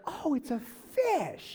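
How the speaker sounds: high, slow, drawn-out realization; wide-eyed childlike "oh"; child's slow discovery. Retold or imitated. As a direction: marvel like a small kid figuring it out. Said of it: "they're like".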